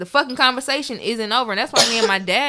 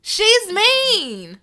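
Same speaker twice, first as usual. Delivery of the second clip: high, indignant retort, rising louder on mean; retold comeback, pitched up; 10 semitones higher, 4 dB louder, much slower than usual. Talking back to her mother about grandma, high and indignant. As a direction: throw it back at mom, pitched way up. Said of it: "And I was like".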